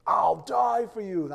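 loud, high, drawn-out declaration; booming mock-heroic vow, slowly stretched; bold boast. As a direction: proclaim it like a grand, overblown oath. Peter's boast acted out loudly.

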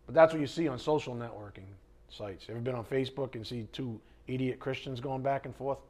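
normal talking voice

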